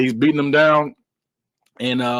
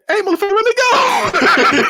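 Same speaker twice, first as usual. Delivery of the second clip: very high, slow comic wail; hammed-up imitation of a pained villain; mock pained yell; 4 dB louder, slower than usual. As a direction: holler high as if your hand is being crushed. Retold villain yelling as hand cracks.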